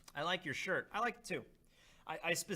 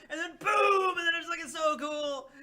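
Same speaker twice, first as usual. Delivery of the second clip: very high, loud hyped yell; excited shout; 9 dB louder than usual. Retold or imitated. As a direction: explode with fanboy joy. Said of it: "my discussion of that chapter would just be like".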